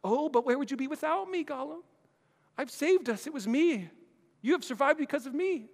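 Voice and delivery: high sneering Gollum boast. Acted. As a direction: gloat like a sly creature.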